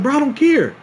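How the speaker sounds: high, loud exasperated outburst; acted mad. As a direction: burst out as if fed up. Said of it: "I get mad. I'm, bl-, I'm mad".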